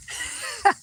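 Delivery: short high-pitched giggle; high squeaky laugh. Real, not acted. Laughs at his own story.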